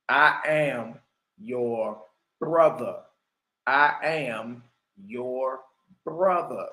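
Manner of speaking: low, slow, solemn divine voice; voice of God. Acted. God speaking to his people, low and solemn. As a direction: intone each word like God speaking. Quoting God's words from the text.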